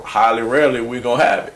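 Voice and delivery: normal talking voice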